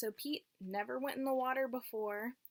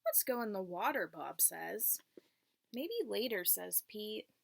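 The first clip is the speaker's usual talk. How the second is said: clear, lilting read-aloud voice for a class; teacher reading a picture book to the class; reading to children. Acted. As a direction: read it like storytime for little kids.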